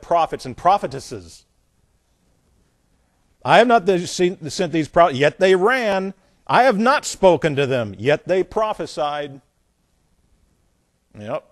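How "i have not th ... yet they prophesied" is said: loud, emphatic Bible verse; forceful scripture reading. Acted. Preacher reads God disowning the prophets, forceful scripture reading. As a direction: declaim God's rebuke with force.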